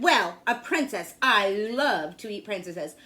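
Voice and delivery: loud, grumbling busy dragon; loud impatient dragon voice. A narrator's reading. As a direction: boom it out like a bored, greedy dragon. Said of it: "The dragon stuck his nose out of the door and said".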